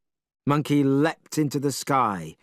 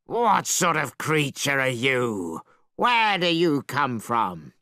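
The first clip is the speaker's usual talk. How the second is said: slow, lightly voiced monster; shouting dragon. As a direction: demand like a puzzled beast. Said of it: "The dragon leapt up and shouted".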